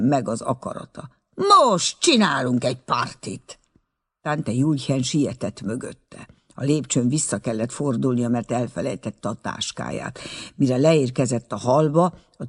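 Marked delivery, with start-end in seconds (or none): none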